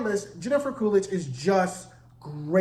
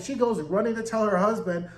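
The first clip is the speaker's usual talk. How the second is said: usual speaking voice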